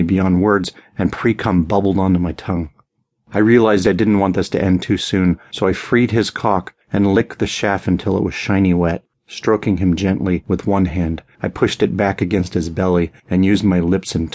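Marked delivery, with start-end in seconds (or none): none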